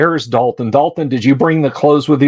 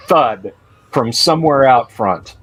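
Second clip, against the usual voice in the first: ordinary talk, nothing marked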